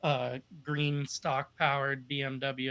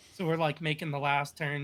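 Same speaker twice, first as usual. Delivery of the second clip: ordinary talk, nothing marked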